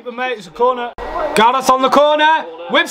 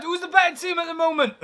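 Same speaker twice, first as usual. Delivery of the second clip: ordinary delivery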